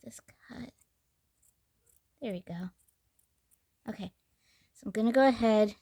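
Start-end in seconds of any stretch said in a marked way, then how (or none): 0.0-4.1 whispered to herself; breathy aside under the breath; whispering. Real, not acted. Muttering to self while cutting.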